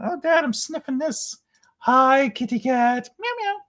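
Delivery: high playful kitty voice, meows at the end; voicing the cat.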